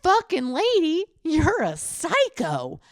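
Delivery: high, loud mocking dude voice; mocking man. Woman imitating the heckler calling her a psycho, high mocking voice. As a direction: sneer back at her as the rude guy.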